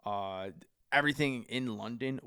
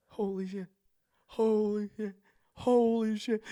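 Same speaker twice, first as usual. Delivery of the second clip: stunned disbelief; 10 semitones higher than usual. Retold or imitated. Stunned after meeting the wrestler.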